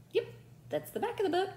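excited praise. Real, not acted. Teacher praising class answer.

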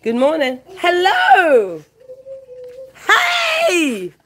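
high, loud happy cry of welcome; greeting someone not seen for ages, excited and loud; delighted surprised greeting. Real, not acted. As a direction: call out with joy at meeting again. Surprise greeting an old acquaintance.

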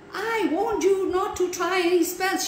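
high, indignant splutter. A narrator's reading. Frog scolding Max about his spells, spluttering. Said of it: "she spluttered".

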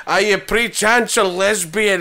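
slow, slurred mock Scottish brogue; Scottish accent. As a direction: ask it as a suave Scottish spy. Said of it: "overcome by his Scottish accent".

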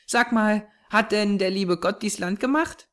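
put-on high child's voice, artless; curious little girl. A narrator's reading. Small girl asking her companion about God, curious. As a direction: wonder aloud in a small girl's high voice.

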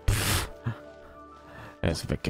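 usual speaking voice

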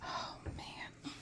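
breathy whisper of dismay; mock dismay whisper. Parent whispering dismay at the mess. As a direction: whisper it like a sad secret.

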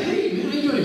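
high, lively mock greeting; retold cheery greeting. Retells cheerful greeting to crying friend.

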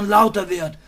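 normal talking voice

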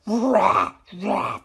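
low playful roars; lion roar. Pretend. Imitates a lion's roar.

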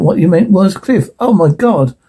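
ordinary delivery

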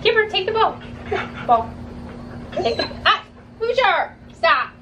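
sharp high-pitched stop; scolding the dogs in a high voice; high dog commands. Real, not acted.

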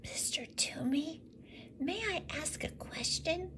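put-on boy whispering a question to the woodcarver. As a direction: whisper timidly to not disturb. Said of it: "Thomas cleared his throat and whispered".

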